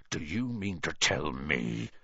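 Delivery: low-key, slow menacing growl. A narrator's reading. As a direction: growl it slowly, holding the anger in. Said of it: "he growled at the Dursleys".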